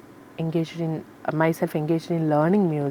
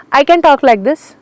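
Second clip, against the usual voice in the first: much higher and much louder, showing the top; high loud range demo; 8 semitones higher, 12 dB louder than usual. Shown as a demonstration. Singer demonstrating upper range to interviewer, high and loud. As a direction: climb high and project hard. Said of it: "range means".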